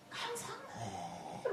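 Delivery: high, slow call from far off; drawn-out name called from a distance; a crowd calling his name far away; distant crowd calling a name. Retold or imitated. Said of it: "막 단체로 부르더래요".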